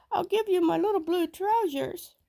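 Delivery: put-on high little boy begging; small boy pleading. A narrator's reading. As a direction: squeak out the plea to the tiger. Sambo offers trousers.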